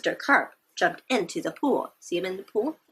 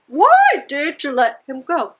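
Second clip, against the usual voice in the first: higher, louder questioning voice; mock question; 4 semitones higher, 6 dB louder than usual. Shown as a demonstration. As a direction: ask it like a curious kid. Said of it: "And you go".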